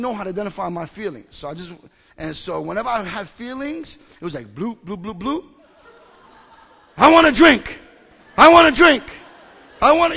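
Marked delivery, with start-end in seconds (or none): 4.6-5.4 quick, bouncy "bloop" noises; imitated bubbling. Imitates feelings bubbling up inside. 7.0-10.2 loud, high-pitched shout; acted craving outburst. Acts out craving bursting out.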